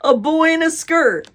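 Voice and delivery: high, loud and drawn out; mocking call. A narrator's reading. Older boy points Casey out to his friend, calling mockingly. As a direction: call it out slowly in mockery. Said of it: "he called to his friend".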